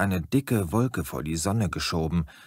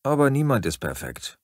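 ordinary delivery, nothing marked

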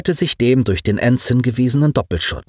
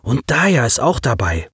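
ordinary delivery

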